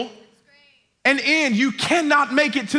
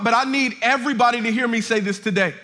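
usual speaking voice

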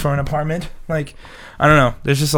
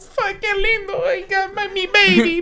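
mocking girlfriend voice. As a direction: gush like a lovesick girlfriend, half sobbing. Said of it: "and she said".

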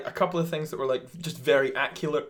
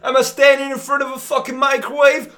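angry rant; 10 semitones higher, 11 dB louder than usual. Acted. Comic rant, shouted.

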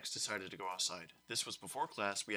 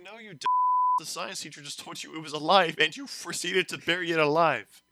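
much higher, louder scolding; laughing mock rage; 7 semitones higher, 10 dB louder than usual. Narrator scolding classmates, laughing. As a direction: scold them through laughter. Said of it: "It's like".

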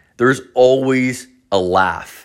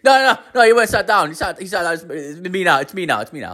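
jittery crackhead; 8 semitones higher, much faster than usual. Retold or imitated. Crackhead explaining the old guy sat down, high and jittery. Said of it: "like, a crackhead behind me".

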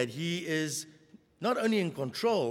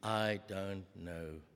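low and soft, drawn out; hushed slow denial; 8 semitones lower, 8 dB quieter, slower than usual. Acted. Preacher repeating Peter's denial, hushed and slow. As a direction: let the denial sink, quietly and slowly.

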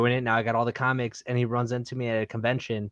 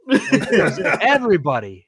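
laughing; 4 semitones higher, 6 dB louder than usual. Real, not acted. Laughing at Rafi's reach.

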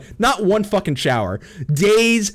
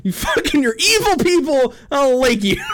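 high, laughing outrage; mock outrage; 5 semitones higher than usual. Real, not acted. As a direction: scold them, half laughing. Angry at killers.

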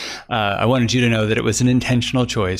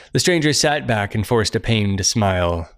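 ordinary delivery

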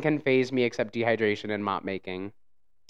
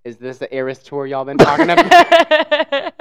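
mock partygoer voice; 10 dB louder than usual. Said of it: "motherfuckers like".

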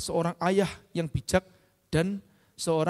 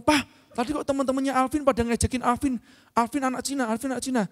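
put-on higher-pitched plaintive child's complaint; imitated tearful child; 6 semitones higher than usual. Son complaining to papa about classmates' teasing, mock tearful whine. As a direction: whine it lightly as a hurt little kid. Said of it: "dia nangis, terus dia ngomong".

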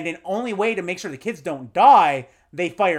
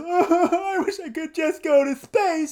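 put-on high, slow whiny kid wish; 11 semitones higher, slower than usual. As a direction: imitate the kid, high and drawn out. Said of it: "he says".

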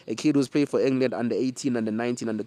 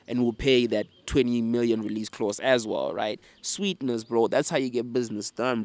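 normal talking voice

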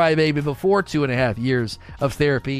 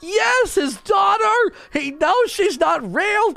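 overwhelmed outburst; slower than usual. Retold or imitated. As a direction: squeal it out like you're sobbing at the TV. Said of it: "And I was like".

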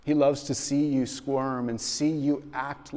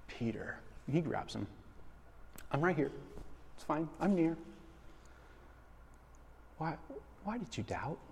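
gentle Jesus; 3 semitones higher, 9 dB quieter, faster than usual. Retold or imitated. Jesus gently voiced rescuing Peter.

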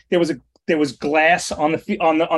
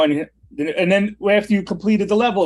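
ordinary delivery

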